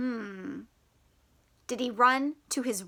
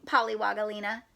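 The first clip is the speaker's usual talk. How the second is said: usual speaking voice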